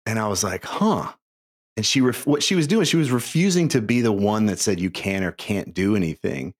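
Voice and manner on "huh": a high, startled little huh; stunned huh. Retold or imitated. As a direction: caught off guard. Reacting to wife's answer.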